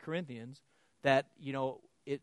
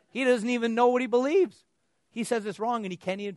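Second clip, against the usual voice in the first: much higher and louder in disbelief; exasperated outburst; 9 semitones higher, 11 dB louder than usual. Real, not acted. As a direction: throw up your hands at him. Exasperated about the man.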